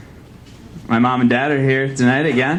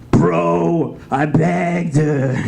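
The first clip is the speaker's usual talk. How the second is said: higher, slow bro voice; 5 semitones higher, much slower than usual. Pretend. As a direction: play a bragging bro. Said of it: "I could be like".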